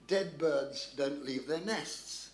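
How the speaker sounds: slow, deliberate read-out; reading out a note. Acted. Storyteller reads Churchill's coded note aloud, slow and deliberate. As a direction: spell out each word slowly. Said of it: "written in Churchill's hand was the words".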